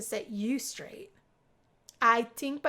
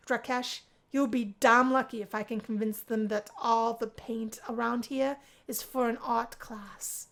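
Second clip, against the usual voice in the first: ordinary delivery, nothing marked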